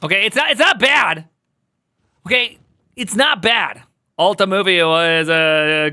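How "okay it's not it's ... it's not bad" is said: defensive insisting. Real, not acted. Insisting the movie is fine.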